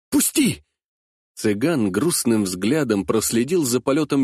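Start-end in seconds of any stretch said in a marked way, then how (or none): 0.1-0.6 high strained cry to let go; wriggling, pulling free; struggling against restraints. Acted. Boy struggles to break free.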